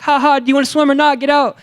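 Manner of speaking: high, loud, fast mock laugh and retort; mocking comeback. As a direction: throw it back at them, loud and quick. He mocks friends back.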